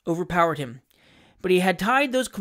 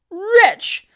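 high, loud, indignant cry; outraged lady; 14 semitones higher, 9 dB louder than usual. A narrator's reading. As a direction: hurl it at the intruder in shock. Frightened lady denounces the intruder.

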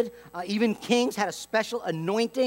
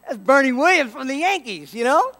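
louder, higher starstruck exclaim; excited fan; 6 semitones higher, 8 dB louder than usual. Retold or imitated. Preacher answering his wife about Bernie, louder starstruck exclaim.

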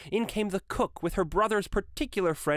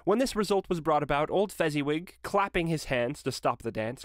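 plain reading voice

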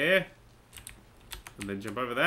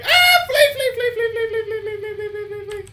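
panicked shouting; 9 dB louder than usual. Real, not acted. Fleeing the spider in panic.

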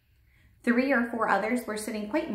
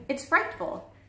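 higher-pitched cry of dismay; appalled cry; 7 semitones higher than usual. A narrator's reading. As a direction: protest it, pitched well up. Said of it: "Sophie cried".

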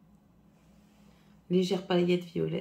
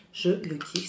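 normal talking voice

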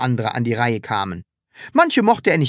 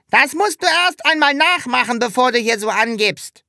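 higher, louder show-off bully; Hubert boasting; 10 semitones higher, 4 dB louder than usual. A narrator's reading. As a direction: brag from the slide.

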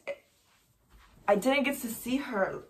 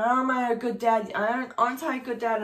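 louder, pressing question, mildly acted; insistent retold appeal; 3 semitones higher, 6 dB louder than usual. Husband appealing to his wife as police hold him, insistent. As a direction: imitate someone pushing for agreement.

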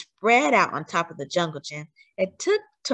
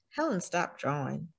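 ordinary delivery, nothing marked

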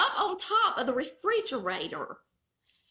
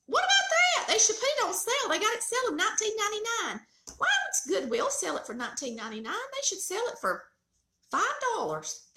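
indignant disbelief; 9 semitones higher than usual. Real, not acted. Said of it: "indignant about the price".

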